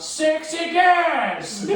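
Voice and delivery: high, loud, slow laughing yell; retold yell. Kids heckling the teacher with a loud yell. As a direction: stretch it out and shout it. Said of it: "we would just yell out".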